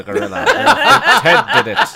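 loud, high, slowed by helpless laughter; cracking up. Real, not acted. Reader breaks down at the absurd sentence, laughing hard. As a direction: squeal the line through uncontrollable laughter.

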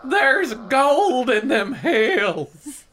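put-on high, slow old-timer voice; old prospector. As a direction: ham up a gold-rush miner. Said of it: "He sounds like an old prospector".

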